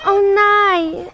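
normal talking voice

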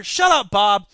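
loud shut-up; telling Bob off. Acted. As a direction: cut Bob off loudly. Silencing the sidekick voice Bob.

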